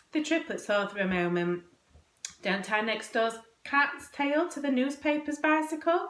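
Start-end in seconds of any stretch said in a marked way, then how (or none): 2.4-6.1 put-on high, questioning boyish voice; guessing. A narrator's reading. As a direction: offer a hesitant guess to mother. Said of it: "guessed Tom".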